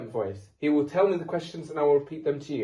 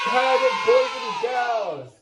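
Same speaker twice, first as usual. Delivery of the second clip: higher, slow hosting shout; game show shout; 6 semitones higher, 6 dB louder than usual. Acted. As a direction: belt it out to the crowd. Host rallying the audience.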